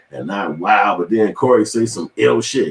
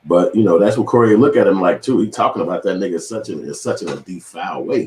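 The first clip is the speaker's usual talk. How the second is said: usual speaking voice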